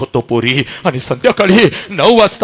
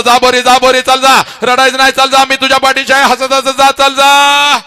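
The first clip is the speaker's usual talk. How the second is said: a higher, louder brave cheer; cheerful send-off; 9 semitones higher, 6 dB louder than usual. Retold or imitated. Father sending his daughter off from the wedding, loudly cheerful. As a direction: push her off with forced brightness. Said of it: "हसत हसत म्हणतो".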